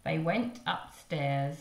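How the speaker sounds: plain reading voice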